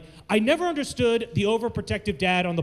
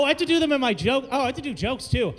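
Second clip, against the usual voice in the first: ordinary talk, nothing marked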